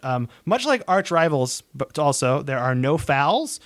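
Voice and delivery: ordinary talk, nothing marked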